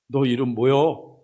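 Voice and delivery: put-on slow, drawn-out Chungcheong country accent; Chungcheong dialect. Stranger demanding Jacob's name, in slow Chungcheong dialect. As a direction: ask it slowly in broad Chungcheong dialect. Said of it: "충청도 버전으로".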